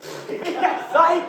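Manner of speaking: high, loud prank reveal; gleeful gotcha. Acted. Comic springs a fake-out after thanking the dean, gleeful shout.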